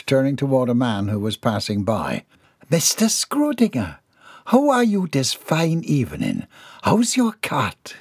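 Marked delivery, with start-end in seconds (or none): 2.7-7.9 higher, bright hostess greeting. Acted. Mrs Dixon hails a passing guest to dodge gossip, higher polite hostess voice.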